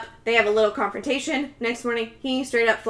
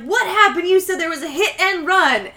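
mock parental confrontation; 7 semitones higher, 3 dB louder than usual. Voices parents confronting their son.

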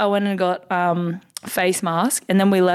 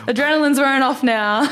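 said through laughter, pitched up; laughing; 7 semitones higher, 4 dB louder than usual. Real, not acted. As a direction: giggle through the line. Laughing at own story.

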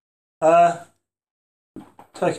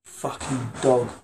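drawn out slowly; 3 semitones lower, 7 dB quieter, much slower than usual. Real, not acted. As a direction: drag the words out. Punchline said slowly.

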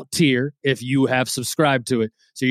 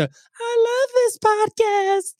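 very high, slow, sugary fan voice; gushing fan; much slower than usual. Retold or imitated. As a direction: swoon like a suddenly loyal fan. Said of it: "changing your one star".

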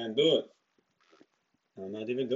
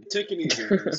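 laughing; 8 semitones higher, 5 dB louder than usual. Real, not acted. Laughing through words.

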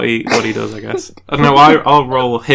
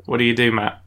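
ordinary talk

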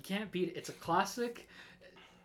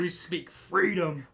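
louder, slow, proud proclamation; mock patriotic declaration; 3 semitones higher, 9 dB louder, slower than usual. Hosts joking about America, slow proud proclamation. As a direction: announce freedom like an anthem.

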